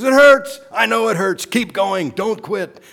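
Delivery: high, loud urging shout; coach yelling. Retold or imitated. Coach shouting encouragement to exhausted player. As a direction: push him through the pain, shouting. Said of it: "He says".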